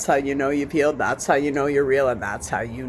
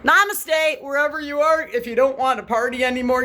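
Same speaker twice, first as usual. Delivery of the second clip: mock sweet namaste; 9 semitones higher than usual. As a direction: chirp a fake spiritual greeting. Mock spiritual sign-off.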